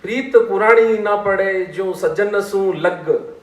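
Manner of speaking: slow, sustained verse recital; strong opening on each line, easing as it closes; couplet recitation. Shown as a demonstration. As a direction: recite the whole doha, stretching each line-end. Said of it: "अब इसको फिर से सुनिए".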